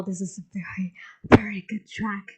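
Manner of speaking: soft whispered verdict; whispering. Real, not acted. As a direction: whisper it like a secret. Hushed verdict on the song.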